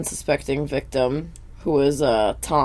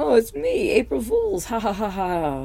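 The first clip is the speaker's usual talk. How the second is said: much higher cartoon voice, teasing and pleased; gloating prankster; 7 semitones higher than usual. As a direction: squeak out the reveal like a giddy prankster.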